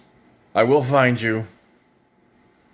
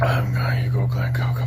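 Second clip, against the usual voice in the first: hushed, voiceless aside to Glenn; sneaking off in a whisper; 4 semitones lower than usual. Real, not acted. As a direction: whisper it like a secret plan.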